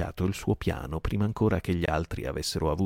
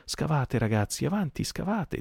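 higher, mock-breezy goading; insolent calm; 9 semitones higher than usual. Silver goads the digging pirates, calm insolence. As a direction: needle them while staying cool. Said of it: "con tranquillissima insolenza".

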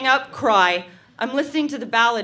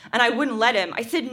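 ordinary delivery

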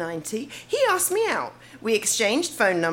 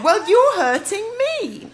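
giggling through a high, louder teasing reply; laughing retort; 9 semitones higher, 4 dB louder, slower than usual. Retold or imitated. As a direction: throw it back at him while laughing. Mocking retort back at him.

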